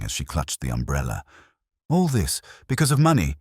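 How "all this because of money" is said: high, fast and louder, words tumbling out; trembling widow bursts out in disbelief, high and fast; upset outburst. Acted. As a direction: she can't hold it in any longer.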